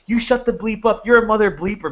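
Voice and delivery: high-pitched retold outburst; imitated yelling. Upset scam caller swearing back at the host, imitated yelling. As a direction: mimic a worked-up caller's yell. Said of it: "He's yelling at me".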